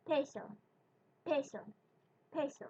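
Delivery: peso with a breathy aspirated p; English accent on peso; English-style peso. Shown as a demonstration. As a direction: puff air on the p of peso. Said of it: "say the word peso like you're speaking English".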